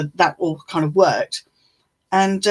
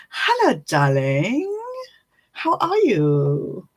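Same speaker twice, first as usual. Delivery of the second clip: fond greeting; 3 semitones higher, slower than usual. Real, not acted. Greeting a friend fondly.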